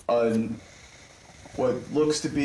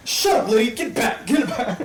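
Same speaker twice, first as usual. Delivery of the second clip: loud, high barking pimp; 11 semitones higher, 6 dB louder than usual. Acted. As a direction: shoo her off like a rough pimp. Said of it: "her pimp come back out".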